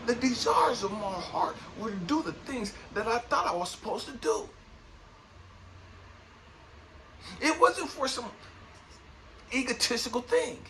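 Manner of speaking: rising, fervent sermon voice; testimony building to a peak; impassioned preaching. Acted. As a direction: preach it with conviction over the music. Fervent motivational preaching over music.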